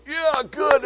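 loud, high relieved cheer; imitated crowd approval, shouted high; retold group cheer. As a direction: holler it like a roomful of sleepers. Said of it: "You could hear everybody go".

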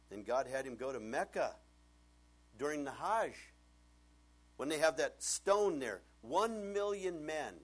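loud, rising preacher's build over the Hajj crowd; preaching at full force. Acted. Preacher telling the congregation about Mecca, loud and building.

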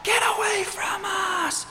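very high, stretched-out demon shriek; screaming out. Acted. Demon-possessed man suddenly faced with Jesus, screaming out. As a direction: scream it slowly like one possessed. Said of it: "demon-possessed guy is just screaming out".

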